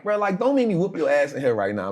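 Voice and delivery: half-joking threat to the cellmate; irritated young inmate threatening cellmate; mock threat. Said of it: "I'm frustrated".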